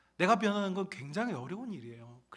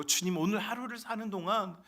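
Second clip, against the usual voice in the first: prayer; 4 semitones higher, 3 dB louder than usual. Shown as a demonstration. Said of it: "그래서 우리는 매일 기도해야 돼요".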